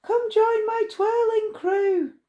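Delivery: put-on high, ringing call to the girls. A narrator's reading. Girl calling every girl on the microphone, high and ringing. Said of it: "She called out loud".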